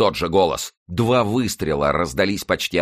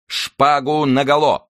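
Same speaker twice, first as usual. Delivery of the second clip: high, slow drawn-out challenge; calling out; 7 semitones higher, 4 dB louder, slower than usual. A narrator's reading. Opponent challenges D'Artagnan to swords, calling out. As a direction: call the challenge out, stretched and pitched up.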